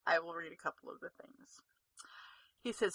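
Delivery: ordinary talk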